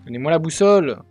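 loud exasperated yell; frustrated shout. Real, not acted. Frustrated demand at game.

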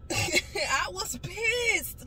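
high, slow, laughing through it. Real, not acted. Retelling how mad she was, laughing. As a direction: laugh as you admit it. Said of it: "laughs while repeating".

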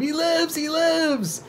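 very high shriek of frantic delight; screamed. A narrator's reading. Witch dancing round her living creation, delighted scream. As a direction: keep shrieking it ecstatically. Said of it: "she screamed".

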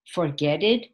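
"forget it" as "for-get-id", lilting up in doubt; wrong past form. Shown as a demonstration. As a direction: test "forget it" as forget with an ED ending. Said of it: "No, that's wrong".

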